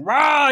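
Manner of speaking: high, drawn-out mock roar; Nicolas Cage. Cage chasing his son, hammed-up roar. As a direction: let out a hammed-up wild yell. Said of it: "doing his Nicolas Cageiest".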